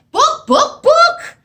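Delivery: very high, loud insistent book book book; clear clucking. Acted. Hen clucks at the librarian clearly, very high and loud. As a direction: cluck it out so she finally gets it. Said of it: "clucked the hen quite clearly".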